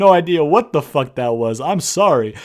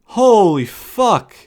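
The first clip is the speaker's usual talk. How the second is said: 1 exasperated; 5 semitones higher, 7 dB louder, much slower than usual. Real, not acted. Venting at Vince.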